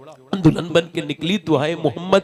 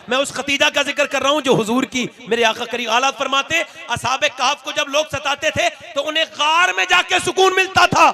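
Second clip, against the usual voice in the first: high, loud impassioned sermon; fiery preaching; 13 semitones higher, 7 dB louder than usual. Acted. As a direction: preach it at full force, shouting. Preaching at full force.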